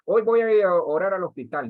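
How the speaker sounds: mock self-sufficient leader. Imitates lone-ranger leader announcing plans.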